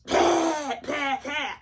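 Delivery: loud mock hacking, no breath first; coughing without inhaling. Wife imitating husband's coughing fit, loud hacks with no intake of air. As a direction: imitate coughs bursting straight out, the first one highest. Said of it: "He didn't inhale first".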